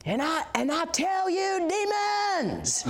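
high, slow, hammed-up revival shout; showman deliverance preacher. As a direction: imitate a preacher theatrically addressing demons. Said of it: "he's like a show".

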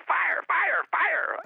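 high-pitched imitation of frantic yelling; retold yelling. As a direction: yell it out in rapid bursts, very high.

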